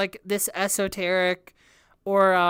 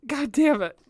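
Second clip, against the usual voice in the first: laughing; 8 semitones higher than usual. Real, not acted. Overwhelmed by confusing lyrics, laughing.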